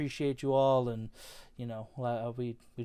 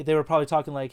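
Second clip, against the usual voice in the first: usual speaking voice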